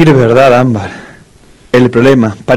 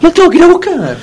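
much higher, giddy eager cry; excited high squeal. Acted. Excited about fetching the charmer. (chillido agudo y entusiasmado)

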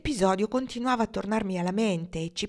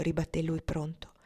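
plain reading voice

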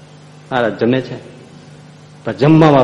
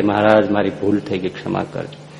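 humble apology; 4 semitones lower, 7 dB quieter than usual. Acted. Disciple apologizes to Maharaj.

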